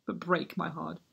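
heartbroken resignation. Acted. Hamlet forced to hold his tongue, low heartbroken resignation.

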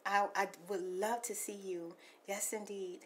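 soft unvoiced whisper to the caller; ASMR whisper. Real, not acted. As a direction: whisper it tenderly.

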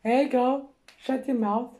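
put-on loud yell at the other girl; shout. Yelling at the new girl to shut up, loud. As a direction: bark it at her to shut up.

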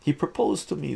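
high, loud daughter voice. A narrator's reading. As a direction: protest to the father as his daughter. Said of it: "the daughter says".

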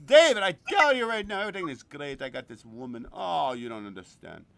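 loud, boasting about a woman; lightly voiced excited friend; imitating Gino. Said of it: "I'll give you another example".

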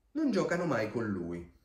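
plain reading voice

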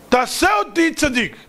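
high insistent command; mock demand. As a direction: demand it like a presumptuous petitioner. Mocks wrong demand made to God.

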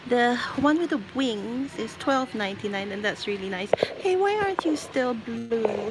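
ordinary delivery

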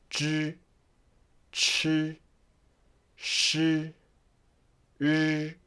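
Zhi Chi Shi Ri held on a level tone; soft, separated Mandarin syllables, steady pitch; Pinyin pronunciation demo. Shown as a demonstration. Teacher gives the third line a try, Pinyin demo. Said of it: "how to pronounce them".

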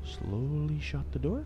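slow, soft and careful; hushed. Real, not acted. Player quietly sneaking away from the monster, hushed. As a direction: breathe the words while sneaking.

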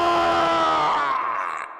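pained cry. Acted. Cry as baby comes.

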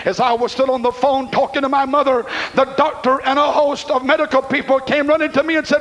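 ordinary delivery

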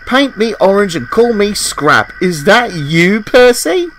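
high, astonished greeting; amazed recognition. A narrator's reading. Stranded engine recognises Percy, astonished. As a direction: rise right up in disbelief on the name.

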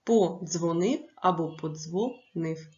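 "Подзвонив або подзвонив" slowly, each "подзвонив" broken into syllables at a different place; syllable-split demo. Shown as a demonstration. Teacher showing both ways to split "подзвонив", slow syllable by syllable. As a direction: say "подзвонив" twice, pausing between syllables differently each time. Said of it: "двома варіантами".

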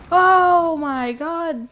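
loud, high, drawn-out amazement; stretched-out shout of astonishment; astonished shout. Real, not acted. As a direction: yell it slowly in real disbelief. Astonished at action in game.

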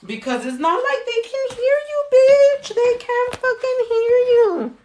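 mocking exasperation. Real, not acted. Mocking the yelling fans.

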